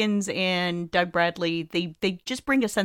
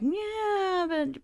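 a high, doubtful "yeah, but" trailing off softer; mock skeptical producers; 11 semitones higher, 5 dB quieter than usual. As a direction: play the unconvinced producers brushing him off. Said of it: "they were like".

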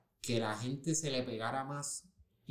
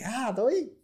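high, upbeat, loud "todo bien"; a cheerful celebrity saying hi to a fan; friendly greeting; 9 semitones higher, 5 dB louder than usual. Retold or imitated. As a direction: greet him like an easygoing star. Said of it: "él me hace como que".

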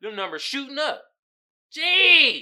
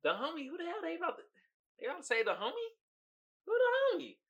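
confused; 9 semitones higher, 6 dB quieter, faster than usual. Retold or imitated. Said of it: "every time I was like".

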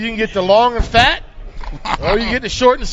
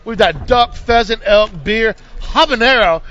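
ordinary delivery

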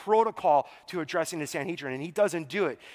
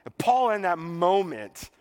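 ordinary talk, nothing marked

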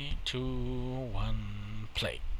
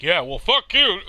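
mock comeback; 6 semitones higher, 8 dB louder than usual. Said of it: "I wish he would be like".

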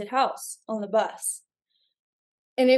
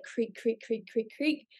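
creaking stairs sound; 8 dB quieter than usual. Retold or imitated.

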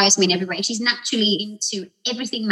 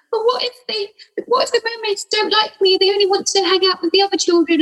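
put-on much higher, anxious child voice; worried little girl; 11 semitones higher than usual. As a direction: fret like a small girl afraid of being left out. Goddaughter voiced, worried about mermaids.

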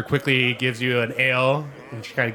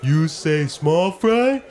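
Small Fry; 4 semitones higher, 3 dB louder, much slower than usual. Acted. Small Fry sneaking up on Gilly, slow and dim. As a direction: drag the words like a slow oaf. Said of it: "he goes up to Gilly and goes".

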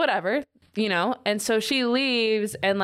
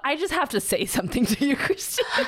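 higher, laughing through the name; mock earnest announcement; 6 semitones higher than usual. Brooklyn mockingly calling out Christina, laughing on her name. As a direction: build up to the name and crack into a laugh. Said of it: "And so then she goes".

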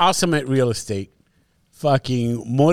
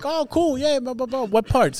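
bright fake small talk; 10 semitones higher than usual. Acting out small talk exchange.

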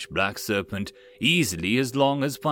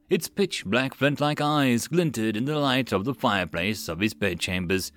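usual speaking voice

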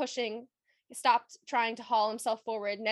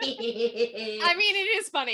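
laughing; 2 semitones higher, 5 dB louder, slower than usual. Real, not acted. Friends laughing over book scene.

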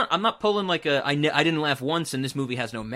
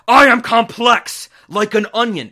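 mock proclamation; 6 semitones higher, 11 dB louder than usual. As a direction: blare it out as a dumb declaration. Mocks Shrek's reply to Donkey.

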